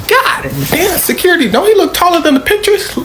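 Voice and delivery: high, fast, sneering jab about his height. Retold or imitated. [name] to [name]'s security, mock high fast taunt. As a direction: pitch it up and rattle it off like a cocky mogul.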